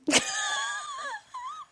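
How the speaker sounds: high, cracking laugh-cry squeal. Real, not acted. Laughing at herself over the last cookie, squealing laugh. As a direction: let a laugh break into a squeal.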